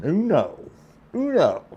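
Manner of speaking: high-pitched, jokey little exclamation; playful Spanish aside. Retold or imitated. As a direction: toss it out high and playful, climbing on the repeat. Playfully repeats one in Spanish.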